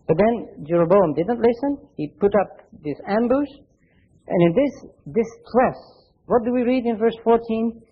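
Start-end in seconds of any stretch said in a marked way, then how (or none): none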